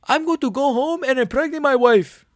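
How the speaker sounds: high fighter impression, full tilt; Moicano voice. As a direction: play Moicano hyped up. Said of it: "That was a decent Moicano".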